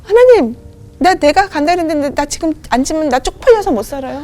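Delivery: sudden high, loud protest to God; protesting outburst. Retold or imitated. Wife protesting to God that leaving is a matter of pride, high and loud. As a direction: cry out in stubborn defiance.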